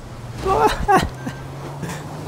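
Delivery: high, breaking burst of laughter; gleeful laugh. Real, not acted. Gamer laughing at rivals going down, high gleeful laugh.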